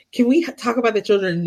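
a hint of a chirpy canvasser; light high pitch, quick; mock canvasser. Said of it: "they frame it that way".

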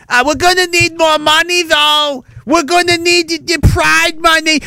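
high, put-on whiny official asking for money; mocking official. Host impersonating officials begging money, high mocking voice.

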